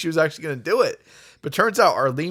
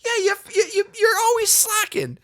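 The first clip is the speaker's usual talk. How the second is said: high mock old-lady scold; mimicking Arlene. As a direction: scold like a cranky old neighbour. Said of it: "she's just like".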